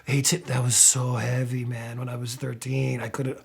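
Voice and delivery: low, soft awed fan voice fading to a whisper on God; imitating a fan. Fan telling Sebastian about the video at thirteen, low hushed voice. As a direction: lightly play a fan, low and hushed, breathing out the last word.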